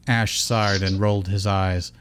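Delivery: ordinary delivery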